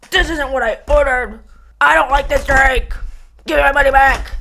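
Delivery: demanding customer. Retold or imitated. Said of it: "But if you're like".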